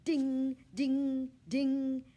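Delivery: high, loud bell imitation; bell ding. Bell on the hamster wheel, high ding. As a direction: ring out like a little bell. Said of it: "Each time the bell rang".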